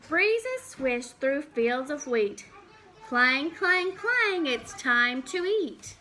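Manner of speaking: put-on lively storybook read for little kids; reading to children. Teacher reads a farm book to the class, lively storybook voice. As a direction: read it out like a picture book at circle time.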